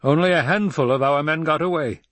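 high, exasperated imperial voice; outraged ruler; indignant Emperor. Acted.

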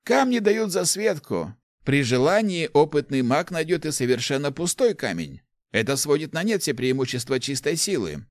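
put-on enthusiastic old inventor. Old inventor explains artefacts to the rector, enthusiastic.

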